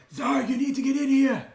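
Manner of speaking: high, loud panicked call for help; panicked yell. Acted. Renard yelling to Zarg in panic, high and loud. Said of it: "you hear Renard yell, uh, in panic".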